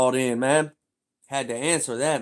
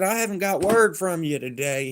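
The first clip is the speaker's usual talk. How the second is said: higher mock boss voice; a hint of the boss; 7 semitones higher, 3 dB louder than usual. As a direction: play the nagging boss. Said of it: "He said".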